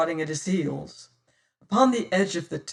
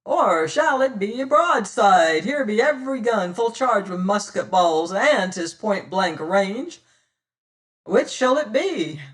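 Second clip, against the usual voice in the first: loud, higher pirate captain's challenge; 4 semitones higher, 6 dB louder than usual. Acted. Pirate captain daring the ship's crew, loud and defiant. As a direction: bellow the ultimatum across the water.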